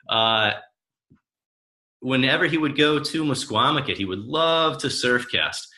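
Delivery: ordinary talk, nothing marked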